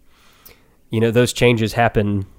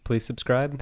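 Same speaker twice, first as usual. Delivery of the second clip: groveling; 2 semitones higher, 9 dB quieter than usual. Real, not acted. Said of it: "kinda sounds like we're begging".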